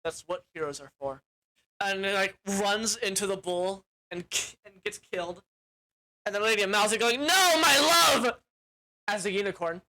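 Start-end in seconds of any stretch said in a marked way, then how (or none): 0.0-1.2 softer, lower noble prince; understated brave hero line; low, quiet heroic vow. Retold or imitated. Prince Lear agrees, heroic. 7.2-8.4 high, loud cry of grief; hammed-up heartbroken cry; anguished mock wail. Unicorn mourns slain prince.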